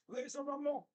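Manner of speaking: "Laser Ramon" in a mock French accent, ending "Ramo" with no n; a hammed-up French accent with a laugh; mock French waiter saying "Laser Ramon". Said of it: "sounds funnier in like a French accent".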